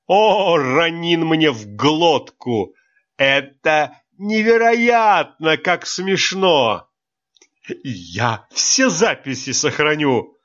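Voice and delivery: high, slow, choking on laughs; sobbing with laughter. Acted. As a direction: sob through the giggles. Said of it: "всхлипывая, сообщил Джай". (всхлипывая от смеха)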